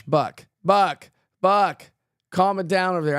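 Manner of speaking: loud playful call to settle down. Real, not acted. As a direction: call Buck to order, half laughing. Telling chatter to calm down.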